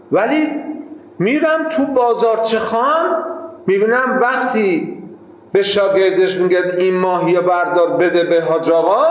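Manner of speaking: ordinary talk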